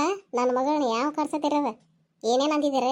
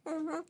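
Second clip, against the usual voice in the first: soft mock sob; 10 dB quieter than usual. Girl sobbing to her mother.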